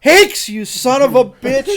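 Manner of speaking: high, loud mock curse at the prank; mock angry outburst. Coworker yelling across the cubicle, mock angry. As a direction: bellow it like a fed-up coworker.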